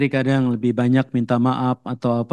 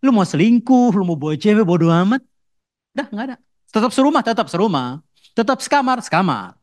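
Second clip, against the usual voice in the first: defiant outburst; 8 semitones higher than usual. Acted. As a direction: fling it back with contempt. Wife's defiant outburst quote.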